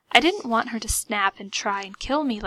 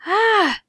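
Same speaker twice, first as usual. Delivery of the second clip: high, loud relief cry; relieved sigh; 11 semitones higher, 8 dB louder than usual. A narrator's reading. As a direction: let out relief with a shudder. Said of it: "she sighed with a relaxed shudder".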